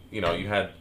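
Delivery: ordinary talk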